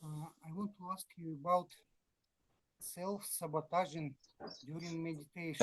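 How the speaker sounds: faint, distant and hard to hear; barely audible. Real, not acted. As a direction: ask it almost too softly to hear. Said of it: "your voice is very low".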